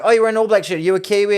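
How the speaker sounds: high, loud imitation of a reporter; eager hailing. Reporter spotting a fan in an All Blacks shirt, eager hailing. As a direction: call out to a stranger excitedly.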